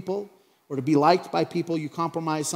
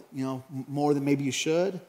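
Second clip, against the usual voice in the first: ordinary talk, nothing marked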